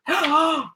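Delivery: sharp excited gasp of wonder; a little gasp of amazement. Acted. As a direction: gasp as if magic starts. Narrated magic moment.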